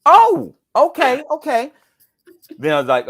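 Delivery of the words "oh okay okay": much higher, louder mock surprise; surprised producer. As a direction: play the producer suddenly getting it. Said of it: "She's like".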